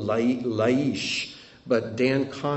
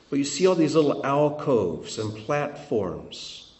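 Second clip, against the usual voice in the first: usual speaking voice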